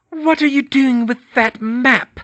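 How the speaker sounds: high, loud, slow interrogation; demanding witch. Acted. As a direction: demand an answer, menacing. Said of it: "Bellatrix demanded".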